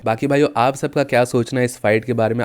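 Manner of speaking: ordinary talk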